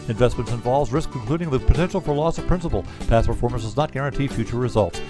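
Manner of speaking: rapid-fire compliance read; fast legal disclaimer. Acted. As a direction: rattle off the disclaimer quickly. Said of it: "recorded disclaimer read".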